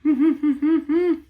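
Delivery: high, light staccato giggle. A narrator's reading. Reader giggles for the laughing animals in the story, high giggle.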